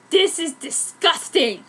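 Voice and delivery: slow, hammed-up revulsion; mock disgust. Narrator reacting to Edward and Rose, slow mock disgust. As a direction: drag it out in fake disgust. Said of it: "I said with disgust".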